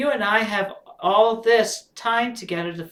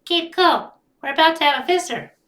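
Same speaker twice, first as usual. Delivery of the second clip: put-on high, louder girl voice; Crystal's voice; 10 semitones higher, 5 dB louder than usual. Crystal warning the family of a visitor, higher voice. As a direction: lift up for Crystal. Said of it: "Crystal then says".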